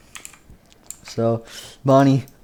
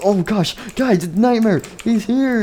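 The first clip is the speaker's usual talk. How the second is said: high-pitched frightened warning; acted panic; 10 semitones higher than usual. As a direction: play a scared character raising the alarm high. Bonnie warns of monster.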